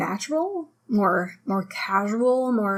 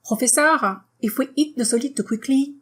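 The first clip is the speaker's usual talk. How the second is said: French accent, rising before each comma; 2 semitones higher than usual. Shown as a demonstration. Teacher demonstrating a student's question, French accent. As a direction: question in a French accent, last syllables stressed. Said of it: "if you read the sentence with a French accent".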